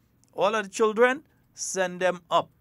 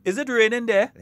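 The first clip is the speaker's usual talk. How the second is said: higher, louder question, acted out; phone caller asking; 5 semitones higher, 8 dB louder than usual. Caller in Saint George asking someone in Saint John, acted phone question. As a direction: call out the question to someone in another town. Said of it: "you call somebody in Saint John".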